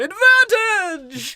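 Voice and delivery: drawn-out high shout of the word; a slow, loud, high mock war cry; stretched mock cry. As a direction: bellow it slowly like a battle cry. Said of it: "was going".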